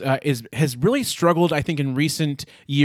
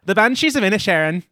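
put-on second try at an Irish accent, a bit higher; lightly Irish title, said with more push; 5 semitones higher, 4 dB louder than usual. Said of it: "I'm gonna try to do this in my Irish accent".

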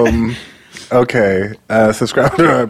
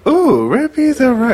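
excited. Retold or imitated. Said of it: "I was like".

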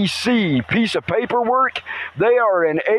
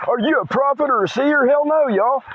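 excited; 7 semitones higher than usual. Real, not acted. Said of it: "I'm excited and flared up".

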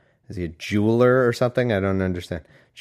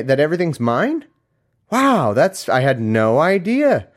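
raised pitch, playing an incredulous God; sarcastic mock disbelief; 4 semitones higher than usual. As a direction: feign being amazed at the news. Sarcastic God answering worshippers.

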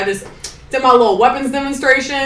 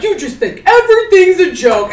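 mocking instructor scold; 10 semitones higher, 4 dB louder than usual.

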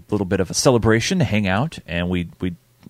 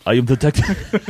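higher-pitched movie-cop impression, breaking into laughter; Detective John Kimball; 4 semitones higher than usual. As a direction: start a mock movie-detective voice, then crack up. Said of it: "Detective John Kimball?".

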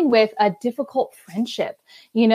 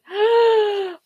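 sharp high mock gasp of overwhelm; 10 semitones higher than usual. Overwhelmed mom gasping at the chaos at home. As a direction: gasp like it's all too much.

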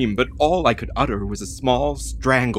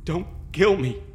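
frightened pleading; 8 semitones higher than usual. Acted. Captive begs mad woman.

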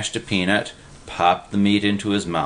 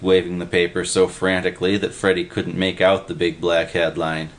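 ordinary delivery

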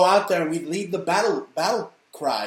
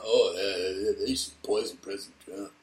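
mocking Bourdain; 8 semitones lower, 4 dB quieter than usual. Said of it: "How about".

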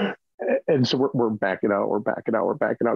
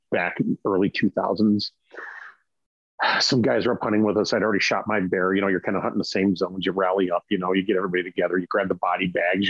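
ordinary talk, nothing marked

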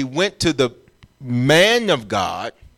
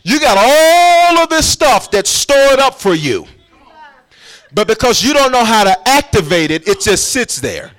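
rising preach; 7 semitones higher, 5 dB louder than usual. Real, not acted. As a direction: lift it up like a climax. Preacher building to a climax.

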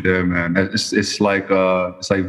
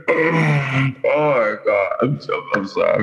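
laughing fit; 6 semitones higher than usual. Real, not acted. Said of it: "I'm tripping".

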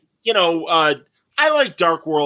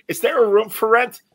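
Janet Leigh; 8 semitones higher than usual. Retold or imitated. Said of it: "like Janet Leigh".

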